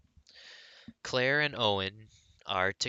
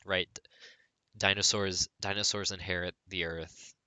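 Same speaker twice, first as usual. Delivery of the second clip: ordinary delivery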